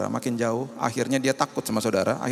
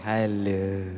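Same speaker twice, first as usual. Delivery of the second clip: put-on talking to a small child; 5 semitones lower than usual. Shown as a demonstration. Pastor showing how we greet small children, sing-song. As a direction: greet a toddler gently. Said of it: "kalau sama anak kecil kan kita ngomongnya gini".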